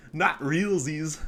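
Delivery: very slow, drawn-out mocking taunt; mock baby talk. Taunting the misspelling opponent, slow mocking drawl. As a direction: stretch it out to mock him.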